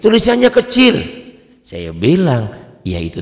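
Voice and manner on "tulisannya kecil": mock complaining lady. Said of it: "Bukan tulisannya jelek".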